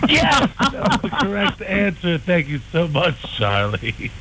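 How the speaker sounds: high, cheesy quiz host announcing the win; host jokingly crowning the correct radio answer; game show host. Pretend. Host's game-show verdict.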